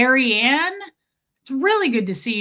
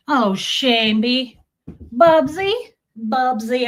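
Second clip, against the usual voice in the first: drawn-out louder pet call; fond calling; 3 semitones higher, 6 dB louder, slower than usual. Real, not acted. She fondly calls to her cat, drawn-out pet call.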